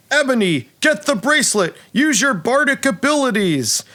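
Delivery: high, hammy battle call; dwarf fighter commanding. Acted. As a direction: call orders to a fellow stage warrior. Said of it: "looks back to another warrior and says".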